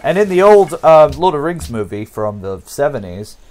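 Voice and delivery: ordinary talk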